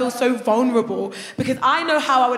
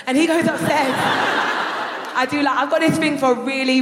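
ordinary delivery

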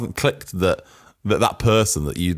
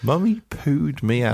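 imitated child; 6 semitones higher than usual. Echoing the child's birth claim.

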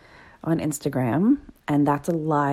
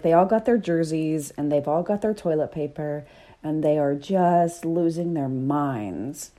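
usual speaking voice

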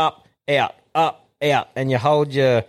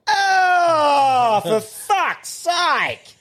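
big voice; much slower than usual. Retold or imitated. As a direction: boom out the fake outrage, slowly. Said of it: "did the big voice like that".

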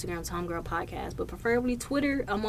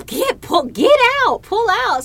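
high, loud imitated yelling; urgent mock order; 13 semitones higher, 10 dB louder than usual. Imitating the partner telling the speaker to pull out, mock urgency. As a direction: build to a frantic, hammed-up command.

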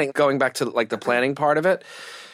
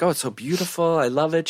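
higher-pitched retold gushing; delighted quote, pitched up; gushing praise; 6 semitones higher than usual. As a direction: imitate a mother-in-law gushing over the venue. Retold gushing mother-in-law at venue.

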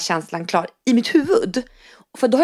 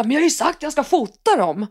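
imitating an irritated snap; 7 semitones higher than usual. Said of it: "flippar Andreas över det här".

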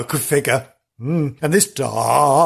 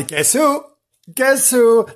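teasing quiz; 9 semitones higher, slower than usual. Acted.

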